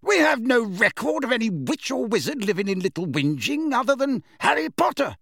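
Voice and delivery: high, emphatic witch judge; Madam Bones, brisk and official. Acted. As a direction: challenge the witness firmly.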